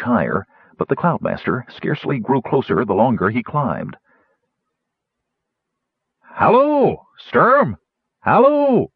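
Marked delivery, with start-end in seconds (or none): 6.2-8.9 high, loud hailing call; gnome calling out. A narrator's reading. Gnome hailing Sturm across the wind, high loud call. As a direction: shout across the storm, high.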